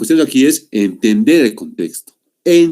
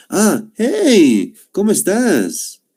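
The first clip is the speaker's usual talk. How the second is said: higher, happy hello; cheerful greeting; 8 semitones higher, much slower than usual. Shown as a demonstration. Teacher acts out greeting a friend, higher and cheerful. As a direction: greet an old friend you just spotted. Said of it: "cuando nos saludamos".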